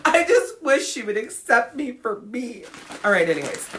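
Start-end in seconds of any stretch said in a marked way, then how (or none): 0.1-2.6 higher-pitched mocking plea; mock wishful women. Imitating women who want her approval, mock pleading. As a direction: put on a pining, hurt voice in jest. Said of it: "the one where they're like".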